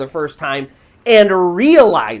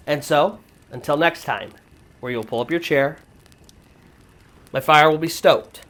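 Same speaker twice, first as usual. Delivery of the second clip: storyteller sign-off; 7 semitones lower, 6 dB quieter than usual. Acted. Storyteller bids listeners goodbye until next tale, low hushed sign-off. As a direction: lower and hush it into an eerie closing.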